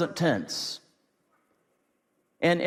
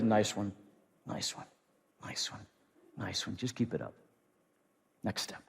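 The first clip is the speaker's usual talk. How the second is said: hushed encouragement; 7 semitones lower, 11 dB quieter than usual. Acted. Jesus cheering over his shoulder.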